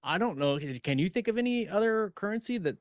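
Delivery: ordinary talk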